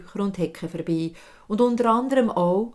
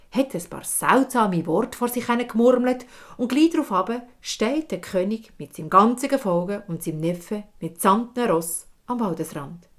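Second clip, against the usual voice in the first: ordinary delivery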